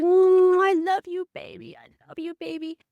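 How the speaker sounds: put-on high, tender baby cooing. Mother holding a baby, cooing tenderly and high. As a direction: coo it like holding an infant. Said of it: "I was like".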